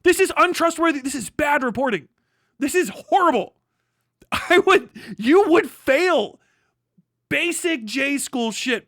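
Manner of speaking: real contempt for bad reporting; angry condemnation. Real, not acted. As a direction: hammer each judgment hard. Condemning the article loudly.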